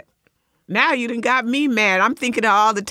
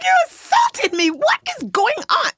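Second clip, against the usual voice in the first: outraged yelling. Retold or imitated. Retelling her airport confrontation, high outraged shout. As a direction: reenact the outburst at the stranger. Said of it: "I'm like".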